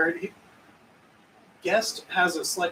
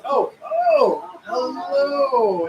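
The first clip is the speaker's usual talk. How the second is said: much higher, a startled oh oh hello; surprised hello; 7 semitones higher, 4 dB louder, much slower than usual. Acted. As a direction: caught off guard, squeak a greeting. Pike's surprised greeting.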